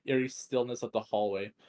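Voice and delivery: plain reading voice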